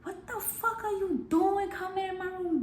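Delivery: high-pitched outraged demand, retold; going crazy. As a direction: act out flipping out on an intruder. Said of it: "I started going crazy".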